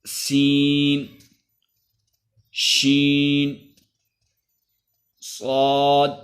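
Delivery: each letter drawn out slowly in recitation; alphabet drill. Shown as a demonstration. Teacher reciting the Arabic alphabet for practice. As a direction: chant each letter long and clear.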